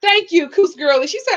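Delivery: ordinary talk, nothing marked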